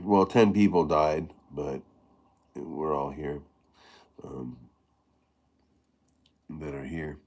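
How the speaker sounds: ordinary talk, nothing marked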